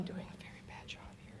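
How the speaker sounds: sighing whisper. Real, not acted. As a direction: breathe it out, defeated. Nervous speaker sighs and whispers.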